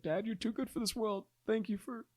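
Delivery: high, soft grateful words; tender. Retold or imitated. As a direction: thank him tenderly. Retold grateful reply.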